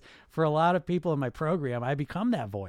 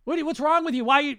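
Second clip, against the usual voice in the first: shrill, loud scolding yell; mock furious outburst at yourself; harsh self-scolding; 12 semitones higher, 10 dB louder than usual. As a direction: scream at yourself for one wrong note. Berating yourself over wrong note.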